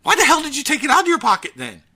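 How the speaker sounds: ordinary talk, nothing marked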